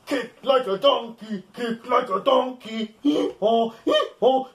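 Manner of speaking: plain reading voice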